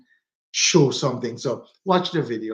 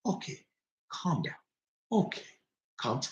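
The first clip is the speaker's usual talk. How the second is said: imitating calm stepdad; 10 dB quieter than usual. Pops calming Mom down, soft and soothing. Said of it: "Pops just".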